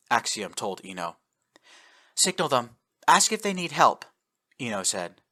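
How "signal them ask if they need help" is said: urgent. Acted. Urgent order in battle.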